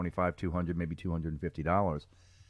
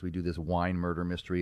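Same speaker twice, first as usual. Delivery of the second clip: ordinary talk, nothing marked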